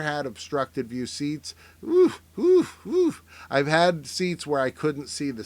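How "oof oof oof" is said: short, high, punched "oof" sounds; high-pitched oofs. Acted. Reacting to obstructed view seats, high comic oofs. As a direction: hoot out a row of high oofs. Imitated noise about bad seats.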